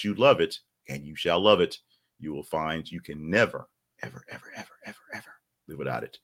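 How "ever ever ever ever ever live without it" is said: fading evers. Pretend.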